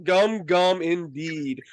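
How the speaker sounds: normal talking voice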